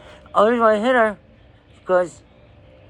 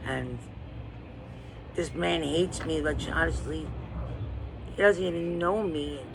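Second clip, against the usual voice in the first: ordinary delivery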